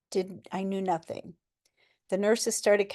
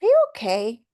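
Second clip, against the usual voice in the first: concerned question; 3 semitones higher, 8 dB louder than usual. Retold or imitated. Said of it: "and he's like".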